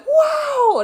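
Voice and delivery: put-on very high, shouted kid's wow; little boy's wow. Recalling the viral kid, a high shouted wow. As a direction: imitate the amazed little boy. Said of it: "that little boy that was like".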